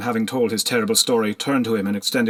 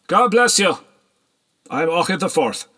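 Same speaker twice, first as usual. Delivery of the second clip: higher, louder greeting; hearty nobleman; 8 semitones higher, 4 dB louder than usual. A narrator's reading. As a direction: greet them warmly and grandly.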